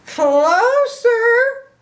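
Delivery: very high, loud drawn-out "closer"; excited squeal. Acted. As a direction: squeal with suspense. Piggy squealing.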